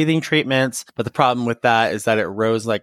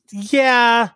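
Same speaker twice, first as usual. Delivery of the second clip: emphatic yeah; 12 semitones higher, 5 dB louder than usual. Real, not acted. Loud agreeing yeah.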